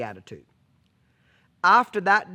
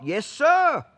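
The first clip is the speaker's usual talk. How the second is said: eager horse voice; 7 semitones higher, 4 dB louder than usual. Pretend. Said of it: "she's Johnny on the spot".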